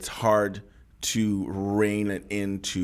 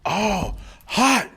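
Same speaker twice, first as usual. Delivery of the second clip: hyped-up movie producer, pitched way up; mock hype; 10 semitones higher, 3 dB louder, slower than usual. Mocking movie producers with a high, excited hype. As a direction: hype the beat like a cartoon studio scene. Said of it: "It's not like on the movies where they like".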